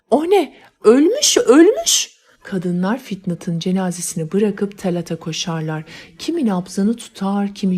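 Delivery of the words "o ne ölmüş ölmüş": shrill panicked scream, much higher and louder; a maid screams on finding the young man dead; screaming at the top of her voice. Acted. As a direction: shriek it out as she finds the body. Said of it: "avazı çıktığı kadar".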